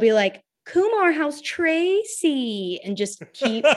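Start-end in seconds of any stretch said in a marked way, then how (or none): 0.6-2.8 much higher, very slow, drawn-out silly fan call; stupid voice. Retold or imitated. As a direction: stretch it out in a dopey mock fan voice. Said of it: "they just say it in this stupid voice".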